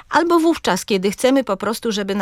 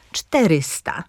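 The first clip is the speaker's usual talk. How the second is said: CZTE-ry-sta, first syllable stressed; "czterysta" with stress at the start; antepenultimate stress; 3 semitones lower, slower than usual. Shown as a demonstration. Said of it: "z akcentem na trzecią sylabę".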